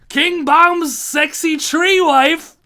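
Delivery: loud, high, stretched-out announcement; gleeful shout. Real, not acted. Ranker announcing the next character, gleeful high shout. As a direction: bellow it slowly with delight.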